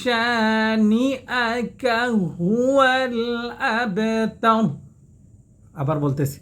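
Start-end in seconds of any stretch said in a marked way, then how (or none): 0.0-4.6 slow, chanted tajwid recitation; Quran recitation. Acted. Teacher recites the full surah for students to follow, chanted. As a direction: recite it slowly, melodic and held.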